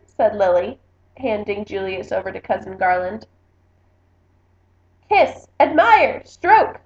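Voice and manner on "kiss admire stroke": slow, loud and high orders; bossy queen giving orders, slow and loud; commanding. A narrator's reading. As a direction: lay down the law word by word. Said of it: "Lily commanded".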